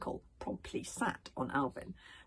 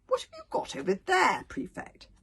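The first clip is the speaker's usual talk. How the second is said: drawled; 13 semitones higher, 7 dB louder than usual. A narrator's reading. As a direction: stretch it out, bored and pompous. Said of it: "he drawled".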